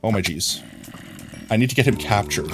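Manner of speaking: ordinary talk, nothing marked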